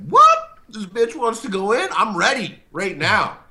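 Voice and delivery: high, loud yelp of challenge; mock fight-ready outburst. Showing his habit of rising to insults, high mock outburst. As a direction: pitch up high, hammed-up and combative. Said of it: "habit of just being like".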